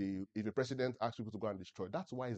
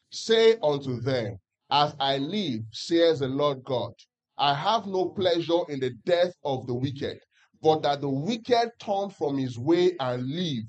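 slow, louder unison reading, every word spaced; louder group scripture reading; 4 semitones higher, 13 dB louder, slower than usual. Acted. Preacher leading the church in reading Ezekiel aloud, slow and louder. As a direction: read it out together slowly, in unison. Congregation reads together.

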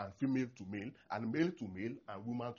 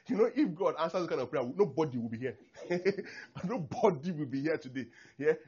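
normal talking voice

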